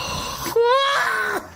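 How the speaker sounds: high, crying-out laugh shriek. Real, not acted. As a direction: let out a wild shrieking laugh. Wild outburst over pulled card.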